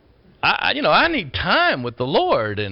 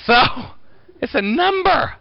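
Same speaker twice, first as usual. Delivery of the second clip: ordinary talk, nothing marked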